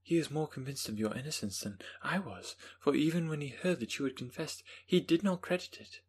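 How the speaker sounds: high, youthful woman's voice; young Elizabeth. Acted.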